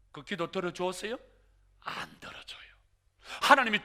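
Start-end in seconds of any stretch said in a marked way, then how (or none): 1.8-2.7 hushed breathy no; whisper. Shown as a demonstration. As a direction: whisper the answer. Whispered answer.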